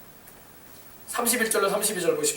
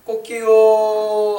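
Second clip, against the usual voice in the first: long, drawn-out cockerel crow; rooster crow; 3 semitones higher, 6 dB louder, much slower than usual. Retold or imitated. Rooster crowing at Peter's third denial, drawn out. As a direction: crow like a rooster, stretching the last note. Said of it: "하면서 닭이".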